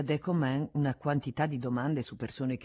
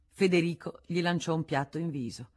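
ordinary delivery

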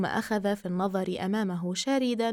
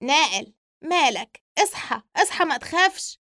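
higher, urgent calls to wake a friend; urgent waking; 5 semitones higher, 4 dB louder than usual. Acted. Sami shaking his frightened friend awake, urgent and high. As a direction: shake him awake with rising alarm. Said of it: "سامي محركا نائل".

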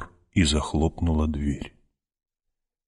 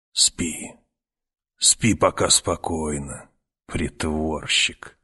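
soft, slow, half-whispered crone; old woman whisper; 3 semitones lower, 4 dB quieter, slower than usual. Acted. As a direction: lull him like a sly old witch. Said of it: "проговорила старуха". (тихий шёпот старухи)

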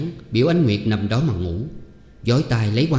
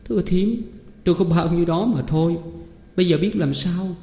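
much higher, wavering plea; near tears; 8 semitones higher than usual. A narrator's reading. Girl tells aunt she has nothing left, near tears. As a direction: answer on the verge of crying. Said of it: "Ánh Nguyệt già khóc và đáp rằng".

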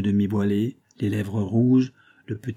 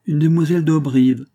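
put-on old man exclaims, louder and higher; raised, astonished outburst; surprised exclamation; 6 semitones higher, 7 dB louder than usual. A narrator's reading. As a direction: cry it out in surprise. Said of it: "s'écria le vieillard".

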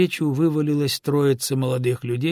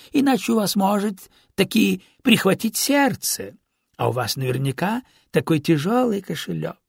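higher, sing-song feigned concern; mock-polite street robber; 6 semitones higher than usual. A narrator's reading. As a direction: threaten them under cover of courteous advice. Street robber politely threatens victims. (налётчик с притворной заботой)

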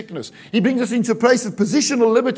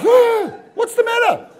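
very high, loud mock alarm; startled wife; 12 semitones higher, 5 dB louder, slower than usual. Wife greeting her roaring husband, acting startled. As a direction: jump with fright, stretched out and high.

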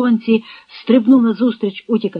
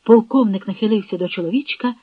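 ordinary delivery, nothing marked

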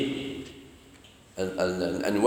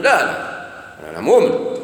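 drawn-out no, then a louder insistence on مؤمن; firm believer; 4 dB louder, slower than usual. Retold or imitated. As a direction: insist you are sure of your faith. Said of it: "مانيش عارف ولا أنا مؤمن". (إصرار واثق بصوت أعلى على مؤمن)

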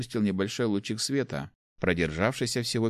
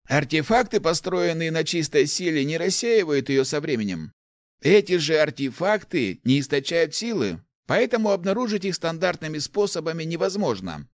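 put-on higher-pitched, animated old man's voice; enthusiastic old inventor; 8 semitones higher, 3 dB louder than usual. Old inventor explains artefacts to the rector, enthusiastic. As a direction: explain your discovery eagerly, pitched up.

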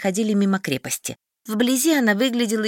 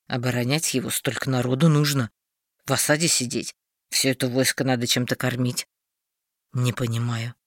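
lower, grumbly warrior; a hint of a grumpy companion; low grumbling voice; 8 semitones lower than usual.

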